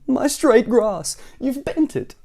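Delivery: high, slow fussy lament; distressed cry. A narrator's reading. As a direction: wail it slowly in dismay. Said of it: "cried Mister Fussy".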